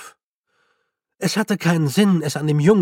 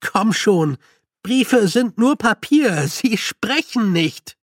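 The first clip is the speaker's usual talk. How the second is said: louder, higher, slow protest; agitated, drawn-out protest; exasperated; 6 semitones higher, 4 dB louder, slower than usual. A narrator's reading. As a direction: argue against the calm golem. Feucht argues with golem.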